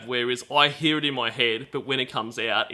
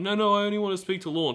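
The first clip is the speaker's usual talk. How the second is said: higher, fast mock-tearful protest; imitated crying plea; 8 semitones higher, faster than usual. Retelling Ramona's outburst on the call, mock crying. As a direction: mimic a tearful, hurried plea. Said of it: "the crying".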